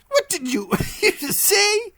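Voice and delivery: high voice with a breathless laugh; laughing question. Acted. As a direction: ask while still giggling. Bartok Wynn asks.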